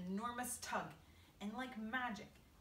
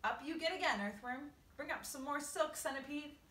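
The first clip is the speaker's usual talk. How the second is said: calling orders; 6 semitones higher, 6 dB louder than usual. A narrator's reading. James calling orders to crew.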